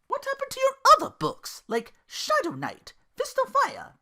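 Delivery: high teasing disbelief; Belle incredulous. Acted. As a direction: sound baffled at his reading.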